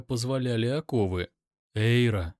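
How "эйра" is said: plain reading voice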